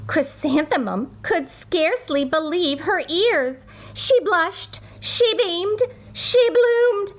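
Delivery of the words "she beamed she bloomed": ordinary delivery, nothing marked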